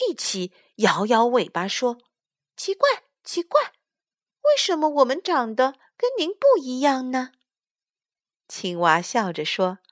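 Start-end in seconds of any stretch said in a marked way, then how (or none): 2.6-7.3 put-on high, wondering child voice; high small creature asking why; puzzled tadpoles. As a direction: wonder aloud as a little tadpole. Tadpoles puzzled at mother frog.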